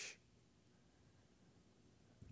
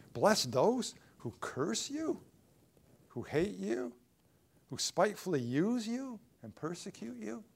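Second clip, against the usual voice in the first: high, drawn-out disbelieving questions; incredulous; 7 semitones higher, 3 dB louder than usual. Acted. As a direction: repeat each command as if it's absurd. Counselees balk at the verse.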